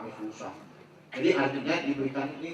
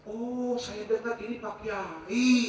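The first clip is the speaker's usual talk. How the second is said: slow, drawn-out high "oh" of recognition; mock-awed, high and slow; impressed recognition; 7 semitones higher, much slower than usual. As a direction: mimic an impressed listener, stretched and high. Woman recognising the preacher, retold.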